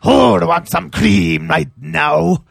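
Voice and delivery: slow, loud, hammed-up call; hammy announcer. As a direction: belt it out like a showman. Hamming the catchphrase, slow and loud.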